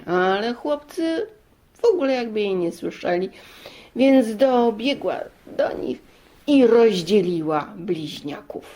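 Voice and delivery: ordinary delivery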